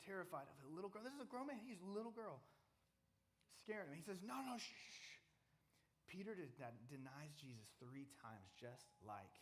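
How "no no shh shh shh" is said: scared hushing. Acted. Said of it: "This guy was scared".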